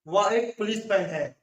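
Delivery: ordinary delivery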